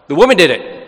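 loud, high defensive blame; blaming voice. Retold or imitated. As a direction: point the finger, loudly passing the blame. Adam blaming Eve in sermon.

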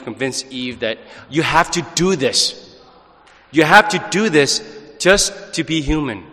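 ordinary talk

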